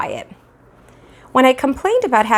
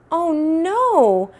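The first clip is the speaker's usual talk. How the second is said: alarmed cry; 9 semitones higher than usual. Acted. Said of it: "my mother cried".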